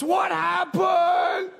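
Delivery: put-on a very high, slow, shouted child's question; boy's shout. Little boy asks the limbless man what happened, shrieking high. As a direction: squeal it out like a startled little boy. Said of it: "he says".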